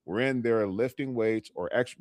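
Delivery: ordinary talk, nothing marked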